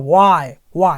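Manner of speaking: why said slowly with lips pushed out; rounded lips on why. Shown as a demonstration. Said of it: "ओठ एकदम बड़े हो जाते हैं बाहर की तरफ".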